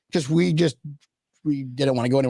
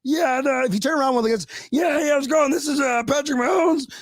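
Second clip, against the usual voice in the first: Kermit the Frog voice; 9 semitones higher than usual. Retold or imitated. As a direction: do a Kermit impression. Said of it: "you don't know what Kermit the Frog sounds like".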